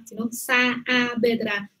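Saavedra with a long double a, slowly and clearly; slow clear model. Shown as a demonstration. Teacher showing the student how Saavedra should sound. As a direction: stretch the first a of Saavedra. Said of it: "Tú tienes que pronunciar muy claramente".

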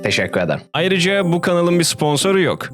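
ordinary talk, nothing marked

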